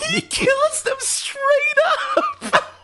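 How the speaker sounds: very high, slow, laughing outcry; real laughing disbelief; laughing outrage. Real, not acted. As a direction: cry it out through laughter.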